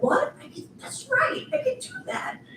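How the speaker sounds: squeaky, high-pitched disbelief at good news; retold high, thrilled little reaction; retold delighted surprise. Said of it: "I was like".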